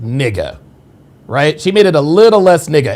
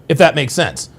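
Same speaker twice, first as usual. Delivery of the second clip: ordinary talk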